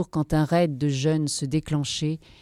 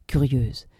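ordinary delivery, nothing marked

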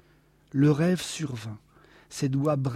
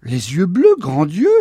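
loud, scandalised outcry; incredulous, forceful exclamation; exclaimed; 9 dB louder than usual. A narrator's reading. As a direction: cry it out in disbelief. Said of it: "s'écria monsieur K".

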